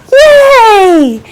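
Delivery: high loud gasp then cheer; joyful cheer. Pretend. Storyteller announcing the baby, delighted yay. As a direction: celebrate the baby.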